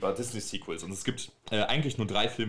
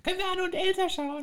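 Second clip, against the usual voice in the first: put-on little girl voice. Imitating the little sister asking.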